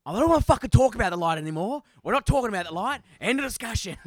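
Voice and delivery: loud, high, fast angry rant; bad-tempered outburst. Snapping at his co-host over the light, bad-tempered. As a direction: explode and shut the argument down. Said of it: "it's my bad temper".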